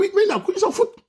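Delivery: high, laughing mock of the men's shrug; mocking careless men. Speaker mocks men who don't care, high laughing voice. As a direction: squeak it out like a careless guy laughing it off. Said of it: "je dois dire aux hommes".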